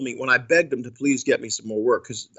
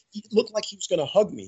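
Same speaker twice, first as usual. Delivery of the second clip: normal talking voice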